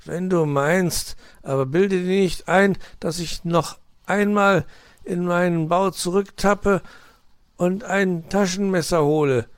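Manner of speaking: high, loud-edged grumble; growling. A narrator's reading. Uncle fox refuses to fetch a knife, grumbling. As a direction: grumble it in protest. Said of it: "knurrte Herr Fuchs".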